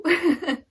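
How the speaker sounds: loud high laugh; big bright laugh; burst of laughter. Real, not acted. Laughs at own joke.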